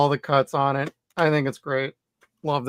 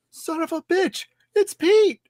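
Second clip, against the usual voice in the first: much higher-pitched mock disbelief; astonished realization; 14 semitones higher, slower than usual. Podcaster retelling spotting an actor on TV, astonished. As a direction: reenact a sudden high-pitched outburst of recognition. Acting out his moment of recognition.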